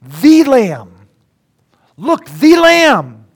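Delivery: The hit loud and high, slowly; stress on The. Shown as a demonstration. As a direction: lean hard on The in The Lamb. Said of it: "five definite articles".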